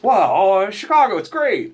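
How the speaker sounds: hammed-up cheerful boost for Chicago; enthusiastic pitch. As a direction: sell the city, high and loud. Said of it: "he goes".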